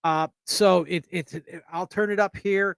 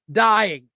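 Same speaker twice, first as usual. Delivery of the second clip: "Dying" punched louder and higher; emphatic repeat; 4 semitones higher, 7 dB louder than usual. Real, not acted. Host praising a friend's funny post, emphatic "Dying". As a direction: hit "Dying" hard as a one-word exclamation. Repeats word to stress hilarity.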